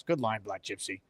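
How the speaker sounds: normal talking voice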